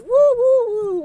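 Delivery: high, loud woo-woo-woo; party whoops to kick off the stream; loud cheering woos. Real, not acted. Cheering herself into showtime.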